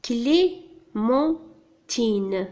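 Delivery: "Clémentine" very slowly, Clé, men, tine; syllable by syllable. Shown as a demonstration. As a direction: break "Clémentine" into three separate syllables. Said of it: "troisième syllabe".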